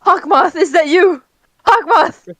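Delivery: mock dramatic call. Player pretends to recognize Hawkmoth in the vision, mock dramatic call. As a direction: call out in playful mock astonishment. Player playfully calls to a villain.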